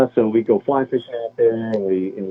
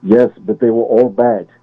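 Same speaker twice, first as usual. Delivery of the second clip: put-on a light Austrian accent, matter-of-fact action hero; a hint of a deadpan Austrian movie-star accent; Arnold impression; 3 dB louder than usual. Retelling the True Lies spy's answer in a light Austrian accent. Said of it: "That was a spot-on impersonation too".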